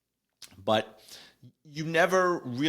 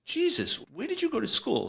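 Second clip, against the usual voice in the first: much higher, astonished exclamation; amazed. Retold or imitated. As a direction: blurt it out, blown away. Amazed at colleague's throw.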